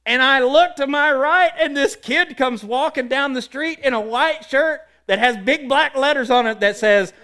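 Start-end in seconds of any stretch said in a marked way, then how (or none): none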